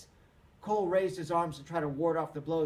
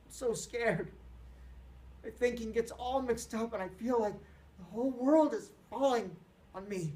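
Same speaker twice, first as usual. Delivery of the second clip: crying; 7 semitones higher than usual. Acted. Peter breaking down crying.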